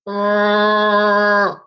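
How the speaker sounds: drawn-out Chewbacca-style howl; Wookiee roar. Acted. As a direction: hold one long Wookiee bellow as a greeting. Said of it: "that was my Wookiee".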